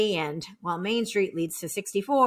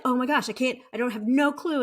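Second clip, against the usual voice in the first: ordinary talk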